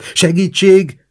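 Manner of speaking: high, loud, drawn-out call for help; shouted cry. A narrator's reading. Guszti running past the bench, shouting. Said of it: "kiabált futás közben, és nevetett".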